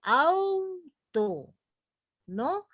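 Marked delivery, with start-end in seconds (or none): 0.0-1.5 "auto" más agudo y alargado, con la U resaltada; ejemplo marcado. Shown as a demonstration. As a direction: canta "auto" alto, marcando la U. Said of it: "pareciera que la A se está muriendo". ("auto" agudo y lento, la U fuerte)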